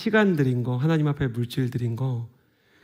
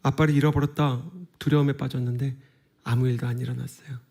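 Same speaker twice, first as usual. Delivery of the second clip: ordinary delivery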